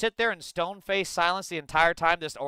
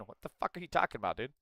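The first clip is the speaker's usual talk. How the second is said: usual speaking voice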